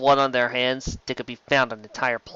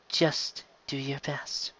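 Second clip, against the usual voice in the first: softer, slow breathy nudge; coaxing whisper; 11 dB quieter, much slower than usual. Acted. Ivanova urging Sheridan to answer, a slow whisper. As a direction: coax him gently under the breath.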